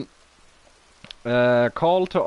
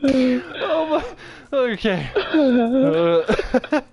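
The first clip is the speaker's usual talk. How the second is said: high wheezing laugh near tears; helpless laughter; 12 semitones higher, much slower than usual. Real, not acted. Host laughing at the duck voice till near tears. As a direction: laugh until it almost turns to crying.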